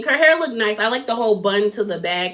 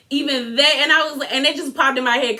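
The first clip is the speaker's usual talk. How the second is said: ordinary talk, nothing marked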